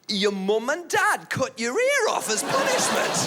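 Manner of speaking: high, slow Northern English heckle; Northern English accent. Retold or imitated. Northern English guy at the back after silence, high imitated accent. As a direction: imitate a little Northern English man piping up. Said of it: "one little Northern English guy".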